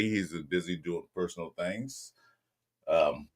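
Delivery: usual speaking voice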